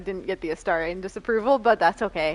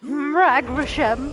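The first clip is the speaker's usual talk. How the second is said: high, loud, drawn-out mimicry; mock war cry; 5 semitones higher, 5 dB louder, much slower than usual. Player imitating a game character's threat, mock war cry. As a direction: echo the cry mockingly after laughing.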